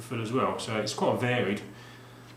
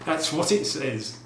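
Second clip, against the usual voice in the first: laughing; 5 semitones higher, slower than usual. Real, not acted. Laughing after reading crude blurb.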